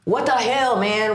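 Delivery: normal talking voice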